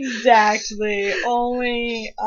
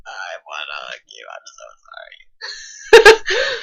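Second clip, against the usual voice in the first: hushed hug, then a slow wail of sorry; half-whispered pity breaking into a drawn-out cry; mock tender sobbing; 5 dB louder, slower than usual. Fan consoling her favourite character, mock wailing apology. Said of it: "so I'm just like".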